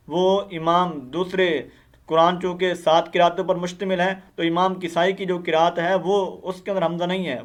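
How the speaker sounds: ordinary talk, nothing marked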